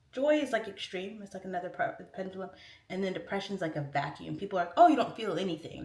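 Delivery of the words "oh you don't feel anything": mock dismissive. Mimicking people's view of depression.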